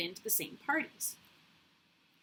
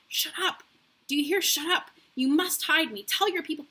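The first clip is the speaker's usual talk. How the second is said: higher, urgent commands, voiced rather than whispered; furious voiced whisper; 6 semitones higher, 5 dB louder than usual. A narrator's reading. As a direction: hiss the orders in her friend's ear. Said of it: "whispered furiously in her ear".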